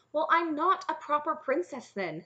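stung, emphatic comeback; snapping. A narrator's reading. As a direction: snap back as an affronted princess. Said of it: "Cimmerian snapped".

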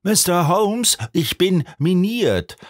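high-pitched cry of despair; high, stretched exclamation at no great volume; desperate outcry. A narrator's reading. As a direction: burst out in distress, voice pitched well up. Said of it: "rief ein Mann aus".

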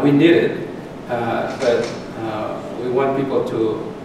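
normal talking voice

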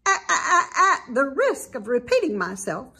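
high, loud stammering goose voice; stuttering goose. A narrator's reading. Goose repeating herself to Wilbur, stammering high.

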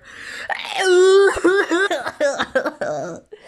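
loud high hammed-up bawling; long cartoon wail; mock wailing. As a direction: burst into exaggerated sobs. Bonnie wails after being rejected.

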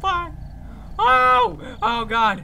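shrill screaming pleas; high panicked pleading. Real, not acted. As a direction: beg in terror.

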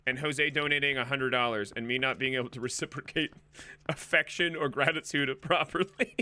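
ordinary talk, nothing marked

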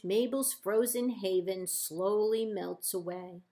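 plain reading voice

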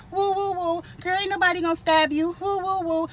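high mocking coo, teasing a scared houseguest; mocking woo woo. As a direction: coo it up high to make fun of her.

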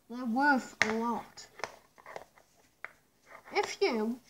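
muffled behind rubber mask; speaking inside sealed respirator; through a gas mask. Real, not acted. Seen 2: Full-face gas mask worn over mouth throughout the labelled words.

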